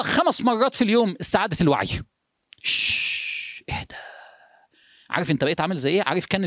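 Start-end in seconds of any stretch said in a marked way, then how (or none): 2.6-3.9 soft unvoiced shh, then a quiet calm-down; hushing shh. Shown as a demonstration. Preacher hushing the restless congregation, soft and soothing. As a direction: hush them like settling a boiling bottle.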